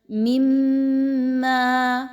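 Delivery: مما with the doubled م hummed long through the nose; teacher models ghunna on مما; nasal meem. Shown as a demonstration. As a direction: hold the م in مما, very slow. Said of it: "آواز کو ناک میں لے کر جائیے".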